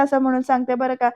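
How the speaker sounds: ordinary delivery, nothing marked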